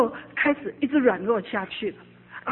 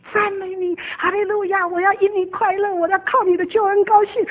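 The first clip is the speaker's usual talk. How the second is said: tearful praise; 8 semitones higher, 5 dB louder than usual. Retold or imitated. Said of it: "很小声的在那说".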